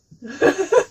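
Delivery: bright burst of laughter; happy laugh. Real, not acted. Storyteller laughing at Tui's joy, delighted laugh. Joyful laugh after shout.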